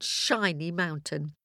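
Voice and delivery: ordinary delivery, nothing marked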